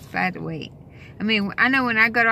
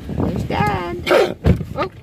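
much higher, louder cooing; 10 semitones higher, 5 dB louder, much slower than usual. Real, not acted. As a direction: show Dad to the dog. High voice for the dog.

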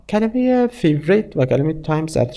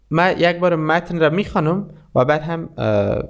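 ordinary delivery

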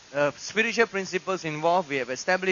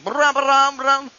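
loud, raised mock thunder noise; imitated thunder rumble; 5 semitones higher, 7 dB louder, slower than usual. Shown as a demonstration. Preacher showing how listeners mishear speech, booming thunder sounds. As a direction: boom out nonsense like rolling thunder. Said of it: "and some of you hearing".

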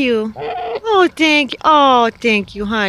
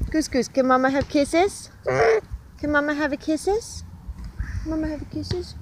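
soft pleading; 6 semitones higher, 10 dB quieter than usual. Real, not acted. Softly asking goose for kisses.

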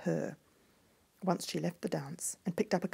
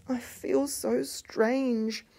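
slow, higher and drawn out; uneasy; 6 semitones higher, much slower than usual. A narrator's reading. Youngest princess tells her prince she feels strange, slow and uneasy. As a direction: let each word hang with unease. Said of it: "said the youngest princess".

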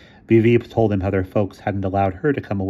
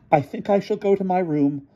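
high, prim withdrawal; prim offended lady; 11 semitones higher than usual. A narrator's reading. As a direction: sniff and retire. Emily retreating, offended.

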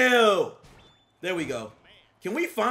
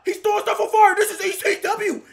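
higher, louder amazed shout; shocked hype; 9 semitones higher, 7 dB louder, faster than usual. Real, not acted. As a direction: react in disbelief at the fire. Shocked at fire in game.

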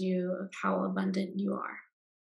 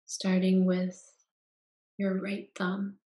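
guided meditation. Acted. Guide leading listeners into Yoga Nidra rest, calm meditation voice.

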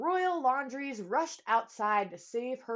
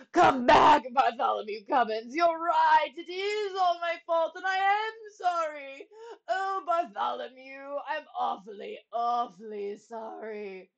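crying king; 5 semitones higher, 7 dB louder than usual. A narrator's reading. Old king begging Bartholomew's forgiveness, crying. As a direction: wail the apology, then let it subside. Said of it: "The old king was crying".

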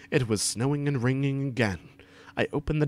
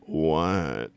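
low reply; 7 semitones lower than usual. A narrator's reading. Acted reply to Draco.

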